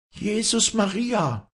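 slow, very high shriek of alarm. A narrator's reading. Women cry out as the fight starts, shrieking. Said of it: "kreischten die Weiber".

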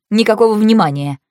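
usual speaking voice

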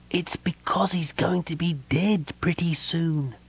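high, hissed stage whisper; loud whisper. A narrator's reading. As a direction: whisper it so everyone can hear. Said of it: "said in a loud whisper".

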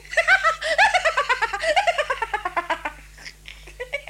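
a long, rising peal of high, loud laughter. Acted. Wife laughs off the question of how he copes, high and long. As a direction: let the laugh climb and go on.

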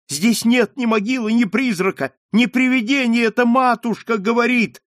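very high, loud, urgent reassurance; crying out. A narrator's reading. Margarita denying ghosts to her father, crying out. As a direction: plead loudly at a high pitch. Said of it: "закричала Маргарита".

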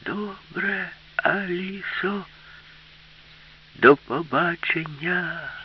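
high, drawn-out dolphin voice; slow, thin, high-pitched farewell, syllables stretched; thin-voiced dolphin. A narrator's reading. Dolphin bidding Alisa farewell from beside the boat, thin high voice. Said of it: "сказав тонким голосом".

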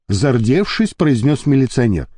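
usual speaking voice

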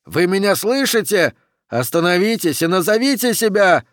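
high, urgent challenge; shouting. Acted. Oxy orders the climbing figures to stop, shouting high. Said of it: "кричал Окси".

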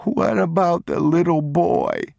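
high, tearful wail; sobbing child. A narrator's reading. Boy crying to papa for the other child, high sobbing. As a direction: sob the question out high. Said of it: "he sobbed".

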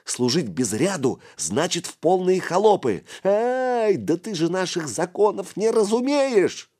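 put-on high, indignant small-boy voice; squeaky, exasperated lecturing. A narrator's reading. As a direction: scold the outsider, pitch climbing. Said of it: "возмутился мышь".

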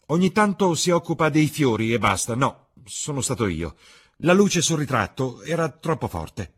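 high, loud, insistent denial; agitated protest. Acted. Dorian explains away the screen to Basil, agitated protest. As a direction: protest forcefully, covering up the truth. Dorian hastily defends hidden portrait.

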